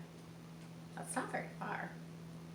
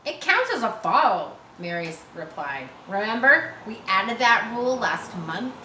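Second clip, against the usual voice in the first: high, loud know-it-all retort; loud reply; 3 semitones higher, 7 dB louder than usual. Acted. Mary correcting Harry, loud bossy reply. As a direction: set him straight loudly. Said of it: "Mary replied".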